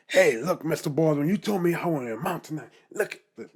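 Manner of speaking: loud, high, gloating show-off; hammed-up boastful call-out; mock bragging. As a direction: brag to your old teacher. Mock bragging to old teacher.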